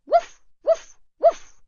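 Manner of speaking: high yappy barks; dog barking. A narrator's reading. Little dog warning off the pirate, high yaps. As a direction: bark like a small dog, high and loud. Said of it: "for good measure she added".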